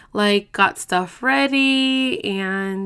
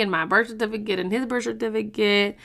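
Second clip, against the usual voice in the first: ordinary talk, nothing marked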